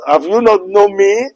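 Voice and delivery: ordinary talk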